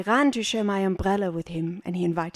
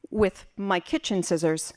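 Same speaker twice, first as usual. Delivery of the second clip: plain reading voice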